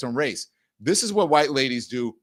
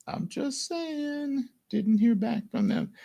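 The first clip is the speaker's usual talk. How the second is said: sarcastic high aside; 14 semitones higher, 4 dB quieter, slower than usual. Real, not acted. Sarcastic aside about briefing room.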